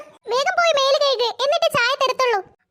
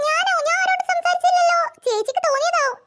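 higher, pleading denial to her sister; fake innocence; 5 semitones higher than usual. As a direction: play dumb, eyes wide. Child denying to older sister.

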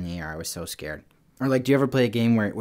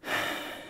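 long, soft calming breath; deep breath; 9 dB quieter than usual. Shown as a demonstration. Showing listeners how to calm down, deep breath. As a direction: breathe deeply and let it out. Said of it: "take a deep breath".

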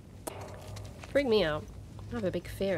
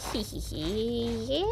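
drawn-out pleased hee-hee; gleeful giggle; 3 semitones higher, much slower than usual. Real, not acted. Streamer proud of a clever move, gleeful giggle. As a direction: giggle slowly, pleased with yourself.

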